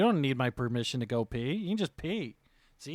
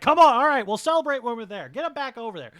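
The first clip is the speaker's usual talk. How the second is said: rallying call; 8 semitones higher, 7 dB louder, faster than usual. Acted. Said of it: "Zapov and Delbog goes like".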